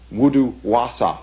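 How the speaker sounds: put-on wudu wasa spoken with Old English vowels; careful Old English wudu wasa; Old English pronunciation. Shown as a demonstration. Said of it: "which means wild man of the woods".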